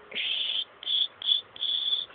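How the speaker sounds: soft, whispered shushing; soothing shush. Real, not acted. Parent quieting a fussy baby, soft shushing.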